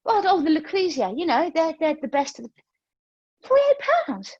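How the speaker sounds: high, flustered justification rising to a squeak; mock flustered. Teacher reenacting justifying the pricey shaker, flustered and high. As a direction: talk yourself into it, flustered. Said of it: "I was like".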